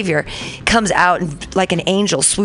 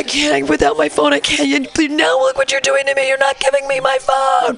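high, pleading whine, much higher; whiny addict voice; 9 semitones higher than usual. Retold or imitated. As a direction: beg for the phone like a desperate junkie. Mocking the brother's begging.

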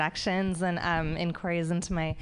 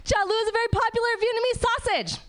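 high, fast victory shout; triumphant reveal; 4 dB louder, faster than usual. Acted. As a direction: yell it out like a winning verdict. Triumphant reveal after drum roll.

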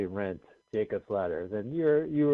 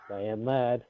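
ordinary talk, nothing marked

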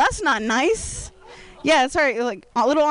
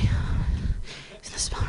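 hushed aside; 6 dB quieter, much slower than usual. Retold or imitated. Girl's words, voiced in whisper.

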